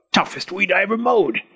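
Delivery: frustrated goblin voice; muttering goblin. A narrator's reading. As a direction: complain to yourself as a goblin. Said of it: "muttering to himself".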